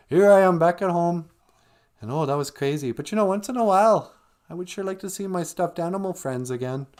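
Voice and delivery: high-pitched mock girl voice; mock Sarah. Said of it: "she goes".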